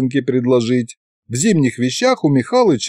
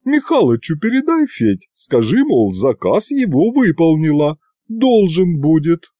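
higher village woman giving an errand; aunt Yaguta's errand; 5 semitones higher than usual. Acted. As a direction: pass the message on, businesslike aunt. Yaguta hands over the vial. (тётка даёт поручение, высоким голосом)

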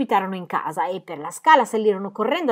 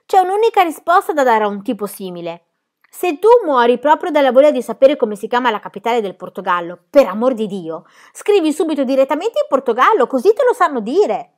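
put-on higher, louder brash girl voice; cheeky girl character; 5 semitones higher, 7 dB louder than usual. A narrator's reading. Cheeky girl answering the adult about Portugal, brash. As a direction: play a bold, cheeky girl.

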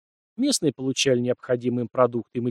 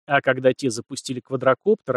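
ordinary delivery

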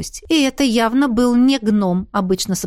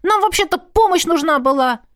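higher-pitched complaint from a twin; aggrieved protest; indignant interjection; 8 semitones higher than usual. A narrator's reading. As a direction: butt in with a grievance. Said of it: "встрял в разговор один из близнецов".

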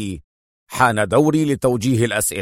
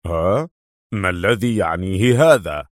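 mild surprise; 5 semitones lower than usual. Acted. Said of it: "فغرفاه من الدهشة".

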